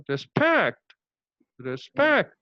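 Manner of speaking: "respect" with a light RE and a strong falling SPECT; stress on SPECT. Shown as a demonstration. Teacher modelling syllable stress, "respect" stressed on SPECT. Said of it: "how to stress upon this syllable".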